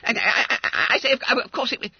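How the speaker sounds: high, flustered schoolboy backpedalling; stammered. Acted. Fat schoolboy trying to escape the deal, stammering. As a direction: stammer nervously, squeaking out excuses. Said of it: "stammered Bunter".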